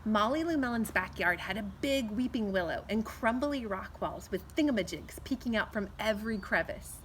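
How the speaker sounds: plain reading voice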